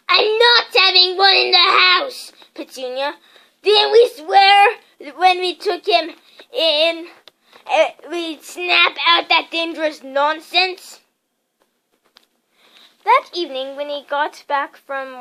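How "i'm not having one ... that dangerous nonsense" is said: loud, slow angry insistence. A narrator's reading. Uncle Vernon insists to his wife, loud and slow. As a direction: hammer each word home.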